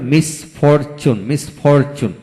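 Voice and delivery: Misfortune in three beats, mis, for, chun; split into syllables. Shown as a demonstration. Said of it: "তিনটি syllable থাকে".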